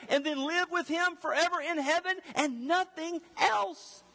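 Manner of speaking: high, sustained pulpit fervor; impassioned preaching. Acted. Preacher telling the church its one need, impassioned. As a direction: drive every phrase home, voice pitched high.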